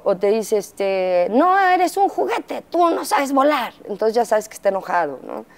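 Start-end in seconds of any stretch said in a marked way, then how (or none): 1.3-3.7 much higher, slow cartoon-like kid voice; imitating angry child. Mother imitating her son quoting Toy Story when angry. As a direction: whine it like a cross little boy quoting a movie. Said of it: "cuando se enoja te dice".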